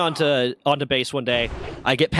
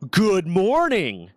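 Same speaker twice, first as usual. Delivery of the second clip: pointed greeting; 6 semitones higher, much slower than usual. Retold or imitated. Officer pointedly returns greeting.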